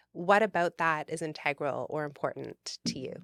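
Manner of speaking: usual speaking voice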